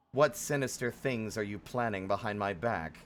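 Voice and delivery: ordinary talk, nothing marked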